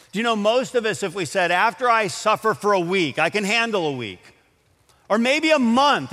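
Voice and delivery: high and loud, building with each phrase; fervent preaching. Acted. Pastor pressing the congregation about bargaining with suffering, fervent preaching. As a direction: drive the point home with rising fervour.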